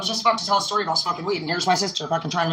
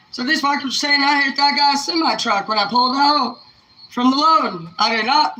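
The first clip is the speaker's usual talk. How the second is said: loud, raised voice of protest; indignant denial; 7 semitones higher, 6 dB louder than usual. Retold or imitated. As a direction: act out an aggrieved man defending himself. Dad's indignant account, acted out.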